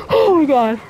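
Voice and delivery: loud shouted laugh of relief; loud relieved laughter then oh my god. Real, not acted. Reaching dry area, relief.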